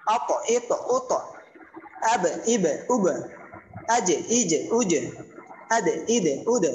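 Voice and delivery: a short echoing release ending আজ, ইজ, উজ, আদ, ইদ, উদ; clipped rebound on each final consonant, as in আদ, ইদ, উদ; qalqala echo. Shown as a demonstration. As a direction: bounce the last consonant of আব, ইব, উব and each like it. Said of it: "প্রতিধ্বনি হবে".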